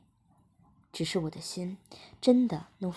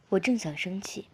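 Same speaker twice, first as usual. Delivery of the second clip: ordinary delivery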